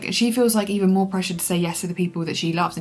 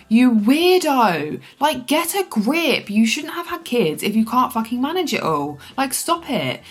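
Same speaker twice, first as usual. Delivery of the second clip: angry rant; 5 semitones higher, 9 dB louder than usual. Real, not acted. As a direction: scold the character, fed up and loud. Ranting at book character.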